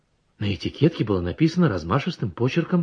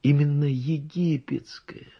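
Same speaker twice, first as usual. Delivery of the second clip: whisper; 5 semitones higher, slower than usual. A narrator's reading. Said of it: "Выражается он торжественно".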